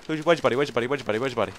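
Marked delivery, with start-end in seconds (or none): none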